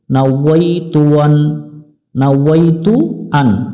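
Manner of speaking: careful না ওয়াইতু আন with a clear w; correct Arabic. Shown as a demonstration. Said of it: "আমরা এখানে পড়ব".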